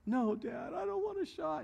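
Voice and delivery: put-on high, whiny little-girl voice; imitating little daughter. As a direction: whine it like a five-year-old who hates shots. Retold five-year-old refusing shot.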